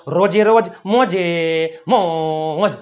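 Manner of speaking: slow sign-off with stretched vowels; chanted-out farewell catchphrase; drawn-out catchphrase. Real, not acted. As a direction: draw out the final word like a slogan. Catchphrase signing off the video.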